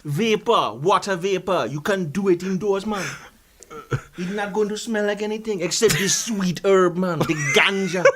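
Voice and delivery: singsong put-on Jamaican patois; fake Jamaican accent. Guest touting odorless herb, fake Jamaican accent. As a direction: sell it in a broad mock Jamaican accent. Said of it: "this is not your real accent".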